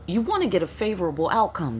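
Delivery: ordinary delivery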